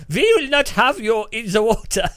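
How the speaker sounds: high, loud hammed-up German-ish cop voice; mock German officer ordering him out; mock German accent. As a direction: shout it like a stern German officer. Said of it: "It's turning German again".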